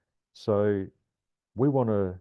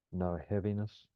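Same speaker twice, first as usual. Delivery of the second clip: calm grounding exercise. Acted. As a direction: ease them gently through the breath. Guides a grounding meditation.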